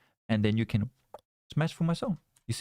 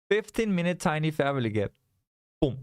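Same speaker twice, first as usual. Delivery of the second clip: ordinary delivery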